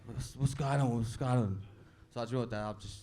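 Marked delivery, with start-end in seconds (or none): 0.1-1.6 lightly voiced dad with a cold, a bit lower; mock stuffy-nosed dad asking what's up; a hint of a sick father's voice. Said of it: "He's got, he's got a cold". 2.5-3.0 a touch of mock self-pity on the phone to dad; mock heartbroken. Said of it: "You don't have to narrate".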